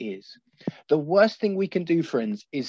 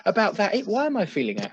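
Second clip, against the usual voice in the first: much higher, exaggerated self-questioning voice; acting out own reaction; 6 semitones higher than usual. Preacher mimics himself reading an angry email, mock high voice. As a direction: play your own startled inner voice, hammed-up. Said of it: "I'm like".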